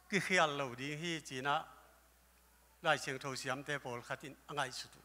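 normal talking voice

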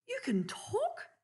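high, slow amazed question; excited. A narrator's reading. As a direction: marvel that the animal talks. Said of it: "cried Dot excitedly".

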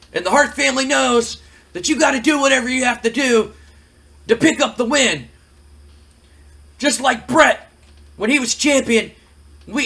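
high, loud boastful wrestler; Owen Hart. Acted. Acts out Owen Hart's boastful interview.

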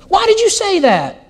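high-pitched wife challenging her husband; reproachful wife. Acted. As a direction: demand an explanation from your husband. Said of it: "she says to her husband".

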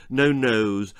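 plain reading voice

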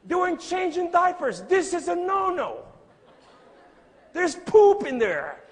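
loud, high-pitched mock outrage; indignation rejouée. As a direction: protest at full voice, comically scandalized. Mock outrage at husbands' chores.

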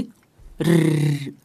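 ルルルル with a fast tongue-tip trill; rolled r. Shown as a demonstration. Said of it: "やってみて".

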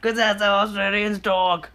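slow, high bad Aussie; mock Australian accent. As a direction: proudly overdo a fake Australian accent. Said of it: "That's the worst Australian act".

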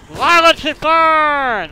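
high, loud, drawn-out complaint; exasperated yell. Real, not acted. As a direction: wail it at the game, stretched out. Exasperated at enemy in game.

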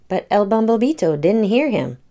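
usual speaking voice

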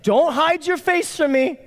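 high, slow, hammed-up desperate begging; desperate plea. David begging God not to hide, acting desperate. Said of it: "the desperation in David's voice".

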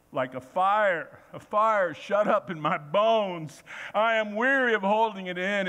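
loud, impassioned reading of the prophet; fervent scripture reading. Acted. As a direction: proclaim it like a fire in the bones. Reads Jeremiah with fervor.

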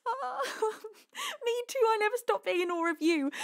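real happy tears catching in the throat; tearful. Real, not acted. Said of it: "so emotional".

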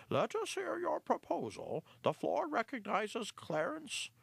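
high-pitched, slightly nervous chairman; an old mouse chairing an uneasy meeting. Acted. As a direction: call the floor with nervous formality. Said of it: "a bit nervously".

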